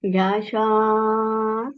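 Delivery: slow, stretched breathing cue; drawn-out cue. Acted. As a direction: draw the words out with the breath.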